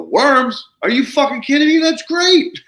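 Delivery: very high, loud burst of delight; thrilled disbelief. Retold or imitated. Retelling delight to a client who found worms, thrilled disbelief. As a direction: exclaim it loudly, voice soaring with disbelief. Acts out delight at worm news.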